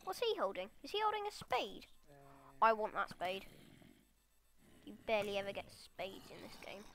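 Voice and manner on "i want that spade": ordinary talk, nothing marked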